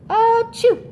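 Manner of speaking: loud high sneeze sound. Acted. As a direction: let out a big sneeze. Sneezing demo.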